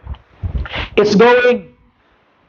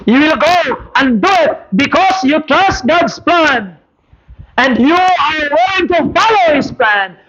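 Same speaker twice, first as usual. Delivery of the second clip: high, pressing sermon drive; preaching; 6 semitones higher than usual. Acted. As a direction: urge the church on. Preaching at full force.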